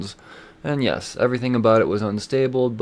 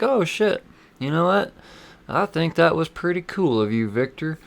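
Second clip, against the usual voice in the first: put-on Southern accent; 6 semitones higher than usual. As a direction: coo it in a Southern accent, pitched up. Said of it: "I don't know why she's Southern right now".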